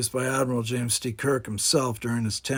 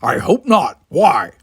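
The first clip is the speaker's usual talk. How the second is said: gruff Klingon, higher and louder, set apart from the narration; a proud Klingon brushing the talk aside; Worf's voice; 5 semitones higher, 11 dB louder, slower than usual. A narrator's reading. Worf confused by Simon.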